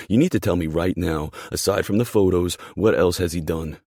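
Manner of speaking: loud, impassioned argument; forceful, angry insistence; heated confrontation. Acted. Heated confrontation between the lovers.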